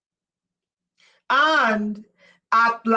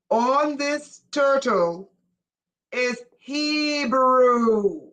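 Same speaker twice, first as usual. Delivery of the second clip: "turtle" and "Hebrew" drawn out slowly, pitched up; slow emphatic repeat; 4 semitones higher, much slower than usual. Shown as a demonstration. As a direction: spell it out slowly so they finally catch it. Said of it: "No, you guys didn't catch that".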